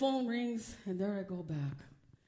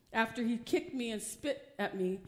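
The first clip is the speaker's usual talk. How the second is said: ordinary talk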